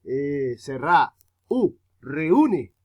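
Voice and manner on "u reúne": isolated "U", then "reúne" with that U punched; "U, reúne", loud emphatic U; stress on U. Shown as a demonstration. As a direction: hit the U hard in "U, reúne". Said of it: "la que más suena duro es la U".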